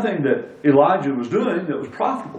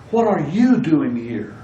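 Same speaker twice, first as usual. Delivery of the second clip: "you" singled out and emphasized, the rest falling; stress on YOU. Shown as a demonstration. Preacher restating the question to the congregation, stress on "you". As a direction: point the question squarely at "you". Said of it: "on the other word in the sentence".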